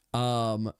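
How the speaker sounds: ordinary talk, nothing marked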